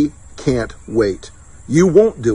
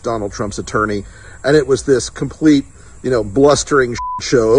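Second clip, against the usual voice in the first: usual speaking voice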